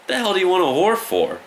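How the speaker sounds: the cop hears the nine-year-old's answer: mock shocked, high and loud.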